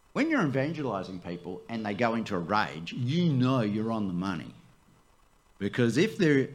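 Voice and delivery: ordinary delivery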